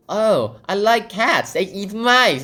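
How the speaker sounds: high, loud, slow innocent chatter; Alice chirping to the mouse, high and slow; cheerful girl voice. Retold or imitated. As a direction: sweetly say something awful. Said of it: "And she's like".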